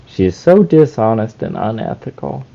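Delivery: a low, soft character voice playing Detective Koichi; deep detective voice; put-on character voice. Detective Koichi voice judging the streamer. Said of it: "What did I say?".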